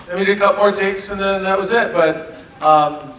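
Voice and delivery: ordinary delivery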